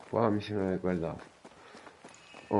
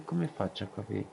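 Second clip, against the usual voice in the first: ordinary delivery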